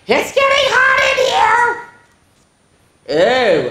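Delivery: very high, slow stretched whine; wailing about the heat; hot and scared. Acted. Monsters set a fire.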